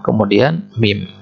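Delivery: usual speaking voice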